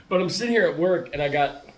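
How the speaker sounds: ordinary talk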